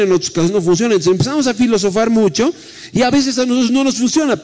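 lively preacher to congregation; preaching. Acted. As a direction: preach with rising fervour. Preacher sermon delivery.

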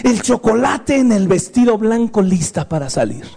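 ordinary talk

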